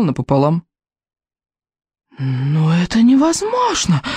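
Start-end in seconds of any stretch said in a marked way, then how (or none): 2.2-4.0 put-on high, slow, bleating old man; bleating disbelief. A narrator's reading. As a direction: bleat it out in shock. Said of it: "проблеял". (проблеять высоко и медленно, в ужасе)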